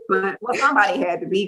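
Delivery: ordinary delivery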